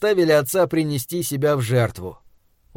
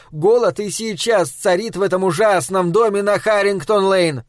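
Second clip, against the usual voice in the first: booming wife; 5 semitones higher than usual. Acted. Wife's voice.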